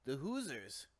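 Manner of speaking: lightly faked Canadian accent; bad Canadian accent. Shown as a demonstration. Host shows his weak impression, half-baked Canadian accent. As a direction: attempt a clumsy Canadian. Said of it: "not too good at the Canadian".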